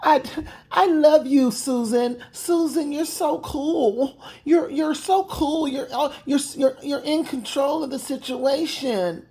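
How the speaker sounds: sarcastic tearful worship; mock gushing fan. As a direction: swoon over Susan in mock sobbing. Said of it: "She speaks like a highly educated boss".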